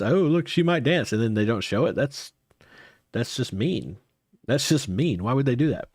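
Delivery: ordinary talk, nothing marked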